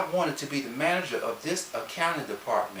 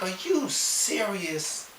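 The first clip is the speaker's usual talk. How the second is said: slow disbelief; 5 semitones higher, 3 dB quieter, much slower than usual. Retold or imitated. Reaction to CFO's evasion.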